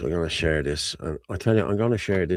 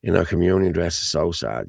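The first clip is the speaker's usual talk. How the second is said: usual speaking voice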